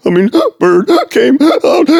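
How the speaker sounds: high, loud mock blubbering; acted-out hysterical sobbing speech; gasping, crying. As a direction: blubber between gasps, barely getting words out. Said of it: "gasping for air and just tears".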